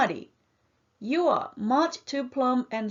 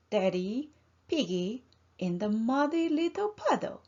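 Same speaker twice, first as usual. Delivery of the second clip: plain reading voice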